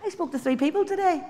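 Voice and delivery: high, bright pleased wow; mock self-praise. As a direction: cheer yourself on. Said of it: "Why don't you say".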